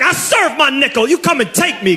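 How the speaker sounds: mock the bravado of a jail veteran; ex-con swagger. Comic imitates guys who've done time, cocky swagger.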